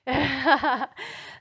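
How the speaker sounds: hearty laugh rising at the end; real laugh. Real, not acted. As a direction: laugh out loud at your own joke. Audience laughing at joke.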